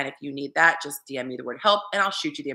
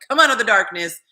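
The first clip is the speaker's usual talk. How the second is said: loud, teasing call; playful summons; 4 semitones higher, 9 dB louder than usual. Retold or imitated. Inviting the anonymous donor to show himself, playful call. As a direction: beckon him out playfully. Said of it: "because I'm like".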